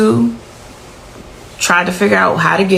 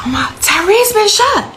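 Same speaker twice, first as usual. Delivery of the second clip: high panicked retelling of a scream; imitating a hysterical caller; retold screaming; 14 semitones higher than usual. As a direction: replay her frantic cry, pitched way up. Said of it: "It was screaming and hollering".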